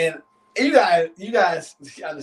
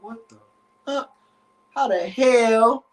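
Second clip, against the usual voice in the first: high, incredulous muttered start, then drawn out; stunned, slow "how the hell"; acted baffled inner voice; retold disbelief; 6 semitones higher, slower than usual. Watching the couple in disbelief.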